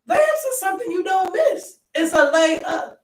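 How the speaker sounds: high, loud disbelieving coach rant; exasperated coach yelling about a missed easy layup. Retold or imitated.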